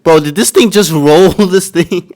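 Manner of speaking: laughing through the question; incredulous laughing. Real, not acted. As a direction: ask it while cracking up. Cracks up at the film.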